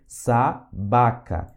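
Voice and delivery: SABACA drawn out slowly, split into syllables. Shown as a demonstration. Teacher modelling a Russian word for beginners, SABACA syllable by syllable. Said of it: "Tem som de S aqui".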